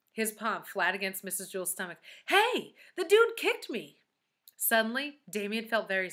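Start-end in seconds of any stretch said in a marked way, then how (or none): 2.3-4.0 put-on high, loud, drawn-out kid's exclamation; startled delight. Boy feeling the teacher's baby kick, loudly amazed. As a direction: exclaim slowly at an amazing discovery.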